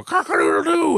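high crowing cock-a-doodle-doo; rooster crowing. Shown as a demonstration. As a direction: crow it like a rooster. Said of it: "you have to go".

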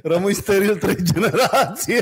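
barely holding back real laughter; laughing through it. Real, not acted. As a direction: crack up while making the absurd claim. Cracking up at own mock claim.

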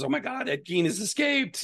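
mock panicked phone call, pitched high; high, excited retelling without extra volume; retold alarm. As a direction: imitate someone urgently reporting an escape.

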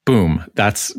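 ordinary talk

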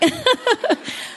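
bright high giggle; delighted giggle at the memory. Real, not acted. Laughs after exciting memory.

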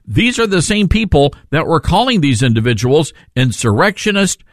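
ordinary delivery

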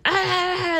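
sudden high yell; mock scream. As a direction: shriek at the kid mid-argument. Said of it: "you could just be screaming at me".

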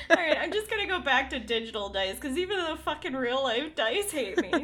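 giggling through the words; laughing. Real, not acted. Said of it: "I'm crying".

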